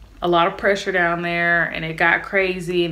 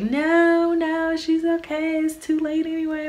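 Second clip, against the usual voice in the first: high, slow drawn-out refusal; imitated dismissive mom; 10 semitones higher than usual. Mom overruling little sister's medicine demand, imitated high and slow. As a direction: overrule them in a high, stretched voice.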